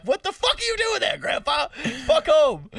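high-pitched, exasperated comic yell; mock outraged scolding. Scolding grandpa at the riot, mock outraged yell. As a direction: tell him off in mock outrage.

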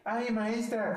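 a lightly voiced child calling teacher; kid voice.

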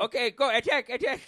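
over-the-top mock war cry; mock terrorist shouting orders. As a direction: play a cartoon terrorist yelling orders down a phone. Joins voicing terrorist giving orders.

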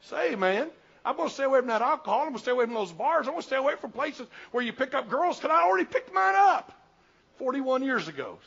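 high, loud, driving preacher's cry; fiery revival preaching; full-force preaching. Acted. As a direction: preach it hard to the congregation.

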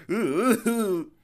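loud high-pitched "ooh"s; goofy soaring "ooh, ooh"; mock power-up hoots. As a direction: imitate silly energy-absorbing noises, hammed up. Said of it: "doing all the weird faces".

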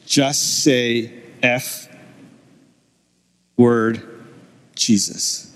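slow, halting sinister voice; drawn out with heavy pauses, sinking at the end; dark voice. Retold or imitated. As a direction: imitate a dark tempting voice, word by word. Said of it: "I hear this dark voice".

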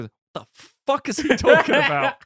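high, incredulous laughing outburst; mock bafflement. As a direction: burst out in comic disbelief. Acts bafflement at the rambler.

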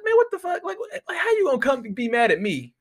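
high, laughing what-the-hell; incredulous outburst. Retold or imitated. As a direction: cry out in shocked disbelief, then laugh at it. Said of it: "I was like".

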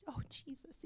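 voiceless hushed whisper; whispered prayer. Retold or imitated. Recalling the Lord's rebuke, whispering Jesus in shock. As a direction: whisper it, struck by conviction. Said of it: "And I'm going".

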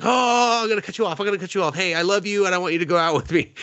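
high, eager put-on voice speeding up; confessing boy.

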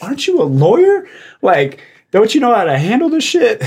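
high-pitched mock disbelief; retold incredulous challenge. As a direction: needle someone in exaggerated astonishment. Retold challenge to fired-up lawyer.

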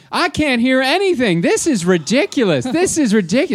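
put-on high, loud complaining older tourist; loud Barb. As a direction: complain loudly in a mock high voice. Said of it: "so loud all the time".

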